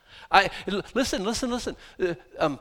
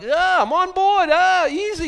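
much higher and louder, slow placating plea; mock cowed listener; 13 semitones higher, 9 dB louder, slower than usual. Listener pleading with the scolding preacher, mock. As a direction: back off, I'm agreeing. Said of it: "and I'm like".

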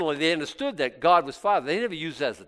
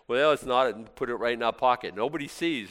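ordinary talk, nothing marked

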